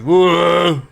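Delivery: loud, high incredulous ogre voice; baffled brute demanding an answer; confused ogre. Acted. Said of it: "The ogre goes".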